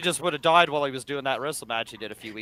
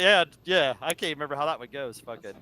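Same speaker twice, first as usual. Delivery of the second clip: normal talking voice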